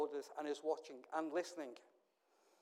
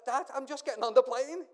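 louder, higher happy news; excited daughter; 7 semitones higher, 12 dB louder than usual. Retold or imitated. Daughter on speakerphone telling dad she's boarding, excited. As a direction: blurt out the good news. Daughter's joyful call from Albania.

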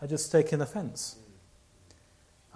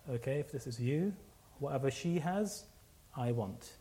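soft, subdued acceptance; humbled assent; 8 dB quieter than usual. Retold or imitated. Annoyed man yielding to God, humbled and quiet. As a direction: give in quietly, voice dropped.

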